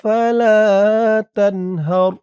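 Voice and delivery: slow, drawn-out chanted recitation; Quran recitation. Shown as a demonstration. Teacher reciting the verse to learners, slow and drawn out. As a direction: stretch each vowel as in tajweed.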